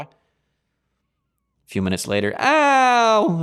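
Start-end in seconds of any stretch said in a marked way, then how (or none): 2.4-3.4 sharp high yelp of pain; child's scream. Retold or imitated. A child touches the burner and yelps, high and loud. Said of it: "The child screams".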